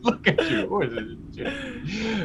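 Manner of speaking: put-on high cooing baby talk, laughing.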